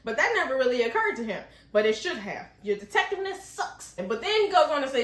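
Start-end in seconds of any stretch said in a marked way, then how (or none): 2.6-3.9 high, unforced jab; mock criticism. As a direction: deliver a teasing verdict without raising the volume. First take of the insult.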